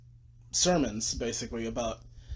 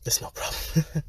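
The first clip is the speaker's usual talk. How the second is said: whisper. Real, not acted. Whispered aside to sleeping sister.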